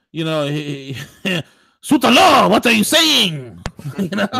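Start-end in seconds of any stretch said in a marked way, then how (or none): 1.8-3.6 mock French exclamation, high and loud; hammed-up Frenchman in a fake French accent; exaggerated French accent, pitched up. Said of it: "The French guy would".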